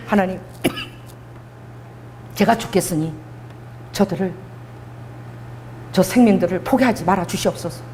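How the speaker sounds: low, soft acted prayer with long pauses; hushed plea. Preacher voices Moses pleading with God for lives, hushed plea. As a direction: plead quietly, halting between phrases.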